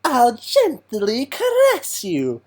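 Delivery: slow, high, with a rolled R; rolled R villain voice. Retold or imitated. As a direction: purr it slowly, rolling the R. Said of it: "he does that rolling with the R thing".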